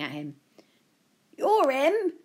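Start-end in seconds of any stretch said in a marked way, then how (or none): 1.4-2.1 lightly voiced old gent, pitched up; a hint of an old gent, higher. A narrator's reading. Old gent spotting Joe in the street, lightly voiced, higher. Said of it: "the old gent said".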